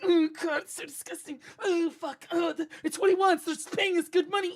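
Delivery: high, strained mock disgust; mock disgusted painters. Body shop workers griping about ugly paint, mocking disgust. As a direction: ham up workers gagging at the paint job.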